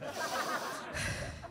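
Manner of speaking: long breathy groaning sigh; loud exasperated sigh. Retold or imitated. Homeless man sighing over the chatty girls, breathy. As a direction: huff over their heads. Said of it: "homeless man hovered over them and went".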